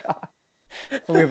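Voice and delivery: normal talking voice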